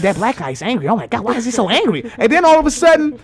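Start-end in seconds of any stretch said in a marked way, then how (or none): 0.0-2.1 lightly voiced shocked passengers; mimicked onlookers. Passengers talking about him, lightly mimicked alarm. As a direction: repeat the gossip aloud, mimicking them. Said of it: "they was like".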